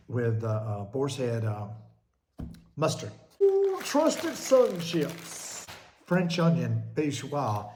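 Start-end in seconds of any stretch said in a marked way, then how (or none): 3.4-5.6 savouring ooh. Pretend. Savours chips.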